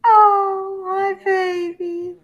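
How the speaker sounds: high, slow cooing pet voice. Real, not acted. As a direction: coo at the cat. Cooing at a cat.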